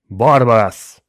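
loud, commanding invocation; summoning shout. A narrator's reading. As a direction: shout the demon's name to summon it. Father summons the demon by name. (громкий призыв демона)

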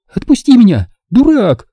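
yelling. A narrator's reading. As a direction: scream it at him while kicking. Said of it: "завопила она".

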